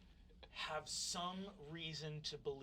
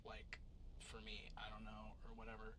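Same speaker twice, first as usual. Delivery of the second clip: put-on low, soft, hesitant teen talk; understated, unsure classmate voice; offhand classmate; 6 semitones lower, 5 dB quieter than usual. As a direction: mumble it low and noncommittal. Voices a shy classmate in game.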